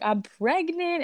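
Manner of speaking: high, slow, half-teary announcement; tearful. Retold or imitated. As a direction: retell the teary news with a catch in the voice. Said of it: "we're both crying on the phone".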